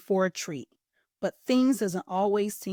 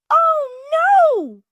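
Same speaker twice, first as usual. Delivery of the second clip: very high, very loud squealing scream; terrified piglet shriek; 10 dB louder than usual. A narrator's reading. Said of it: "screamed the piglet".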